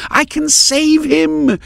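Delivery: high, slow, desperate scream. A narrator's reading. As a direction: Maris screams at Heron to let her pass. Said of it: "she screamed".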